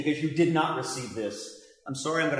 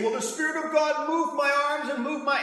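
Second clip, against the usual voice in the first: mocking the excuse in a much higher put-on voice; 12 semitones higher, 4 dB louder than usual. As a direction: mimic a silly excuse, pitched way up.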